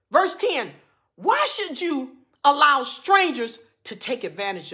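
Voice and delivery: loud, high preacher reading scripture; fired-up preaching. Acted. Preacher reads warning verses to the congregation, fired-up and loud. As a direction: drive each verse home, drawing out key words.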